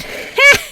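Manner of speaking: high, loud giggle in character. Acted. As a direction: burst into a ghostly giggle. Spirit laughing mid-line.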